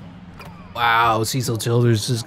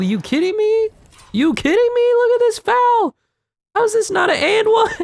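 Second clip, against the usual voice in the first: outraged disbelief. Real, not acted. Streamer outraged the foul was not called, crying out high. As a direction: plead with the ref in disbelief.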